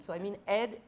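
ordinary talk

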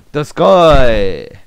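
loud, slow sumo grunt; sumo shout. Real, not acted. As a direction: bellow it like a sumo wrestler.

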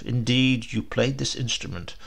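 ordinary delivery

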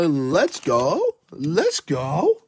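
ordinary talk, nothing marked

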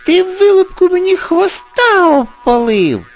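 very high, loud scolding; shrieking angry crow. A narrator's reading. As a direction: screech at the culprit as an outraged crow. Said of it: "закричала ворона". (обурений крик ворони, дуже високо й гучно)

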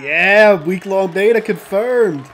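loud, slow happy cheer; excited cheer. Real, not acted. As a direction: celebrate the news. Cheering beta announcement.

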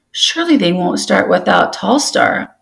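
ordinary delivery, nothing marked

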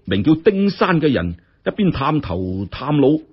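plain reading voice

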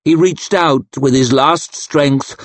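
plain reading voice

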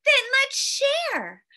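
put-on little boy. A narrator's reading. Little boy offering to share with the snowman, lightly childlike. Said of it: "a little boy said".

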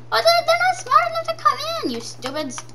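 high, fast taunting squeak; mock the dumb zombies in a silly high voice; teasing put-on voice.

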